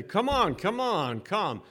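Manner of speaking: put-on high, loud calling out to a child. Shown as a demonstration. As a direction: call the kid over, coaxing. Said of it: "Here in America, we say".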